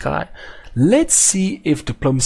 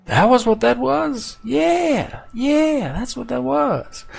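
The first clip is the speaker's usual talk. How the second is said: high mock realization; 9 semitones higher, slower than usual.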